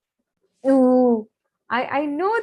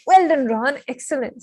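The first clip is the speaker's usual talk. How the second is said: pompous; 4 semitones higher, 6 dB louder than usual. A narrator's reading.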